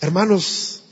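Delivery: ordinary delivery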